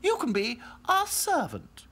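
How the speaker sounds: high haughty doll voice; snooty little princess. A narrator's reading. Princess dolls.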